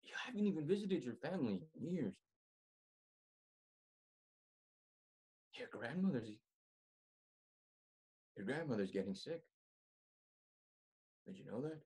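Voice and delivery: soft and low, sincere reassurance; tender plea. Acted. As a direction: speak gently, like a brother who cares. Confessing love as a brother.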